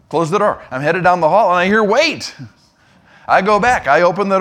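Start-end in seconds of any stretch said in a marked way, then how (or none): 1.8-2.3 high-pitched mock call of "wait". Pastor to departing intern from his office, calling out. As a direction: call someone back through a door. Boss calls intern back again.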